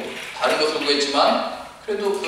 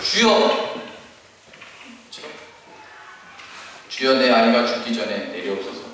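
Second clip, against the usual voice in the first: a cry of "주여!" then pleading lower; earnest plea; 5 semitones lower than usual. Acted. Desperate father begging Jesus, earnest cry. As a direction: call out, then beg with all your heart. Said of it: "얼마나 간절했겠어요".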